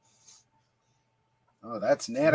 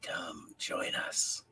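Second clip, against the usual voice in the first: whisper; 9 semitones lower, 13 dB quieter, much slower than usual. Pretend. Whispered invite.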